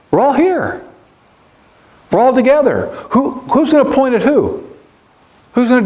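high, fervent preaching cry; climbing preacher's appeal; rising fervor. Real, not acted. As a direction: lift the congregation up. Preacher rallying the congregation.

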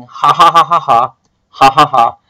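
gloating mock cackle; hammed-up smug ha ha ha; pulsed ha sounds. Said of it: "they will play N seventeen thinking".

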